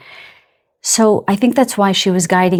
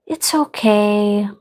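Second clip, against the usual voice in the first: loving parent; 3 semitones higher, slower than usual. Retold or imitated. Spirit guide stroking the speaker's hair, slow and soothing. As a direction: draw it out tenderly to comfort. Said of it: "like she's stroking my hair".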